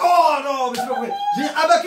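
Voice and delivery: high, loud cry of disbelief; loud comic frustration; loud dismay. Real, not acted. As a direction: exclaim at your own bad luck. Losing the round, dismay.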